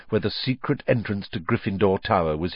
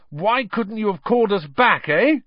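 teasing twins; 12 semitones higher, 4 dB louder than usual. Acted. Twins tease in crowd.

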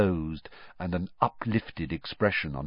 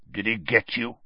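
low, gravelly growl; Moody. A narrator's reading. Moody checks on Harry after the attack, low gravelly growl. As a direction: growl it with a rough edge. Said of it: "His voice was low and gravelly".

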